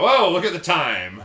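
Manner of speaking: hammed-up hint of an awkward guest; mock polite excuse. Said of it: "They go".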